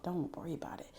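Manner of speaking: whisper. Real, not acted. Whispered aside after spoken line.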